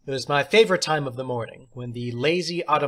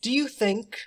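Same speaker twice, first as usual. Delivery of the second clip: theatrical teacher; 10 semitones higher than usual. Acted. Substitute teacher praising John, high and theatrical. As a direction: play the odd substitute, grand and pleased.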